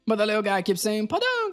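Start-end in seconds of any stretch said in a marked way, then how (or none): 1.1-1.5 very high, tiny squeak of a hamster; high chirpy critter voice; squeaky hamster voice. Acted. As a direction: pipe it up like a tiny happy creature. Hamster creature speaks.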